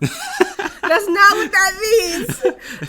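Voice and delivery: a high, slow protest dissolving into laughter; laughing protest. Real, not acted. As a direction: laugh through it as you deny it.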